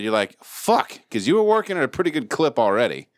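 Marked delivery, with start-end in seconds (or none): 0.4-1.0 high, drawn exasperated swear; frustrated curse. Acted. Worker handed a pile of work, cursing in high frustration. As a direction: let out mock frustration. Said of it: "naturally you're frustrated".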